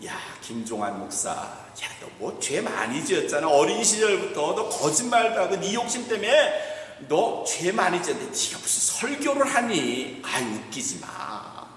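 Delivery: Satan mocking the pastor, low and scornful; Satan accusing. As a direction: taunt him with his sins. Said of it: "어떻게 하는지 아세요?".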